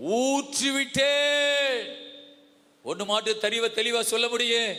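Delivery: high, impassioned sermon voice; full-force preaching. Real, not acted. (உரத்த ஆவேசப் பிரசங்கம்)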